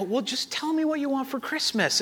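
put-on soft, high voice to a child; gentle coaxing. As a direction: tiptoe carefully around a crying kid. Retold coaxing of calmed child.

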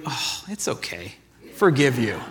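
quiet, lightly voiced fake forgiveness; soft and sarcastic, like you don't mean it; a hint of a mock-gentle pardon; mock sweet forgiveness. Mock reply after getting shot.

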